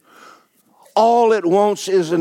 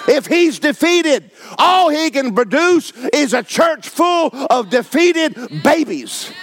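full-force preaching; 7 semitones higher than usual. Acted. Preacher to congregation, fiery full-force preaching. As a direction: preach it hard and high, fire in every word.

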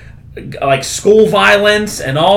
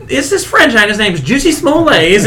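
higher, put-on storyteller voice; mock French story; 7 semitones higher than usual. Friend retelling a comedy bit puts on a higher voice for the comedian. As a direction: tell it as a fake news anecdote, pitched up. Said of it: "He's like".

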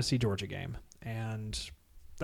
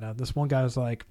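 ordinary talk

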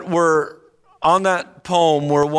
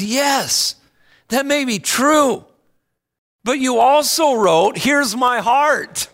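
high, slow, pleading quote of the hymn; higher and slower, as if begging him to remember; earnest pleading woman; 7 semitones higher than usual. Acted. Woman pleading with the hymn writer, higher and slow.

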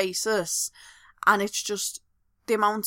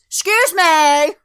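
slow, loud, indignant "Excuse me"; calling out sharply for attention; mum's loud slow call; 8 semitones higher, 11 dB louder, slower than usual. Retold or imitated. Her mum impatient in the shop queue, loud and drawn out. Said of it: "my mum goes".

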